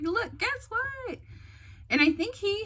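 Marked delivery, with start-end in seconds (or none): 0.0-1.2 very high, drawn-out teasing reveal; excited announcement. Retold or imitated. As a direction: imitate someone bursting with news. Sister announces the big news.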